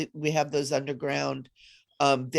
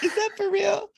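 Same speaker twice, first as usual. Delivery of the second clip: very high, incredulous echo; playfully hammed-up disbelief; mock disbelief. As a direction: squeak it out like a baffled doubter. Echoes the doubters in mockery.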